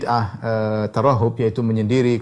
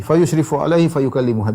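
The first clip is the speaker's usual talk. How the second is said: ordinary talk, nothing marked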